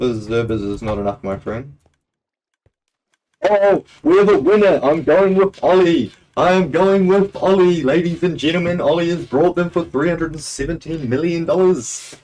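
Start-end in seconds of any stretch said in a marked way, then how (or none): 3.4-12.1 loud, high hype announcer calling a winner; mock auctioneer. Streamer announces the auction winner to viewers, mock auctioneer hype. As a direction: play an excited auctioneer shouting out the sale.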